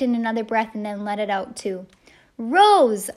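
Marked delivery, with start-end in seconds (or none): 2.4-3.1 long, loud holler into the darkness; drawn-out shout. A narrator's reading. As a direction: let the name ring out high and loud. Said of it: "I hollered into the darkness".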